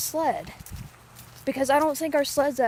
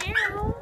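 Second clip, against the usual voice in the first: high mock meow; 10 semitones higher than usual. Meowing at a cat.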